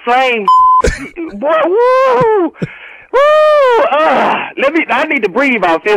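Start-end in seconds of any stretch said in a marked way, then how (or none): 3.1-3.8 a loud high whoop; husband letting off steam after the prank; high relieved woo. Real, not acted. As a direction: let out the tension.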